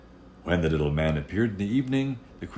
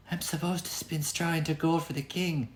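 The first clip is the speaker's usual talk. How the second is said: put-on a small frightened girl's voice; tearful girl; 12 semitones higher than usual. Girl in the locked room tells the little man, high and tearful. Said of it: "said the girl".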